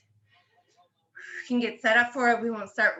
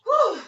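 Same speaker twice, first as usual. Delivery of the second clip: high, loud whew after effort; relieved exhale; 10 semitones higher, 5 dB louder than usual. Real, not acted. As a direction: blow out the burn. After hard tricep dips.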